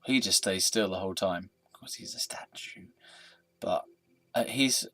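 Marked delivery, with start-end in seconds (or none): 1.7-2.9 whisper. Real, not acted. Deadpan aside.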